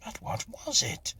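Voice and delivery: high, pressing impatient question. A narrator's reading. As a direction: demand it, losing patience. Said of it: "I said impatiently".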